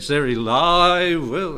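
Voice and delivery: drawn-out American preacher imitation; slow, emphatic mock-American accent. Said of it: "in his American accent".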